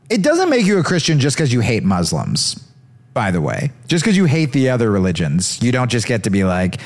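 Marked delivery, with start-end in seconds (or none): none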